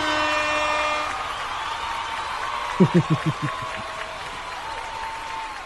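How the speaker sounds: long high breathy wheeze of laughter; high wheezing laugh. Real, not acted.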